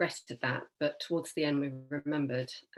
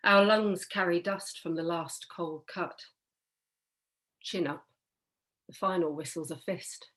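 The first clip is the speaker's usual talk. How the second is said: poetry reading. Acted. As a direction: read each image separately, with pauses. Reads own poem aloud.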